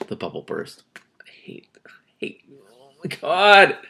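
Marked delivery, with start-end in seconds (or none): 1.0-2.4 breathless whisper of dismay; whispered dread. Real, not acted. Reader reacts to bubble bursting. 2.7-3.8 loud, slow, high oh my God; shocked outburst. Real, not acted.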